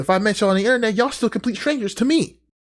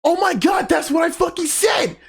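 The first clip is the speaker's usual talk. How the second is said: excited shout; 10 semitones higher than usual. Real, not acted. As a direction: shout it out in delight.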